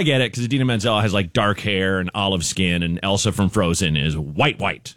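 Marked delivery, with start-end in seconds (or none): none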